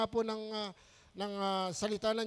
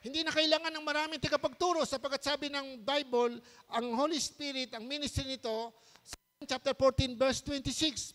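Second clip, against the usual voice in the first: raised, insistent preaching voice; 8 semitones higher than usual. Acted. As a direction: preach it to the congregation with fervor. Preaching at full force. (nangangaral nang malakas at taimtim)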